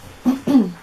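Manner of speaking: light throat clear; clearing a dusty throat. Real, not acted. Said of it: "my throat's back to normal".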